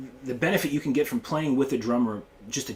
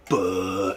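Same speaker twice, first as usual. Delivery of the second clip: bass note imitation; 5 semitones lower than usual.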